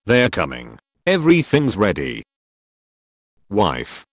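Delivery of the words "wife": plain reading voice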